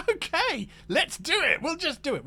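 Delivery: high-pitched, chuckling okay; playfully eager agreement. Acted. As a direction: agree gamely, pitch jumping way up. Acted cheerful agreement to backers' requests.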